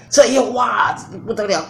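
sudden high, loud "哇"; exclamation. Acted. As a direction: burst out as if it is a big shock. Exclaiming at the dramatic turn. (夏娃亚当吃了果子后，惊呼一声)

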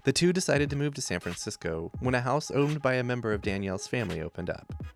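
smooth scripted podcast intro; podcast host read. A narrator's reading.

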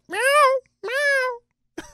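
very high silly meow; meowing like a cat. Pretend. Joking to his cohost that he meowed back, a very high mock meow. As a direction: mew it like a little kitten. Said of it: "I was meowing like a cat".

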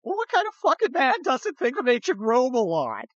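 high-pitched mock disbelief; incredulous retort. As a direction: retell it as an astonished comeback. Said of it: "my response to that was".

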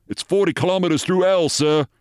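high, urgent sergeant's report; British sergeant. Acted. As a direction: report to your officer, high-pitched and urgent. Sergeant warns his captain.

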